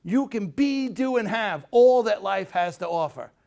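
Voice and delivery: high, slow and loud, drawn out; Zig Ziglar inspirational line. Retold or imitated. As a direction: kneel and deliver it like a motivational preacher. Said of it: "He goes".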